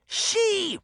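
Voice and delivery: very high witch screech; accusing witch. Acted. Witch pointing gnarled finger at Sabrina, screeching.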